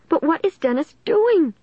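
high-pitched frightened insistence; terrified. A narrator's reading. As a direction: ask in high, anxious terror. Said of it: "tight with terror".

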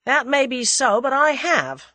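dry American pushback; dry retort. A narrator's reading. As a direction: counter firmly and dryly. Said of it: "said Julius dryly".

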